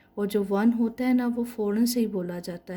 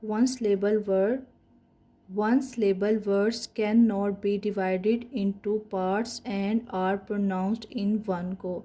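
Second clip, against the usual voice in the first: very slow, word by word; spelling out the book line by line; slow textbook reading; much slower than usual. Shown as a demonstration. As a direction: read it out slowly for the class. Reads textbook English aloud.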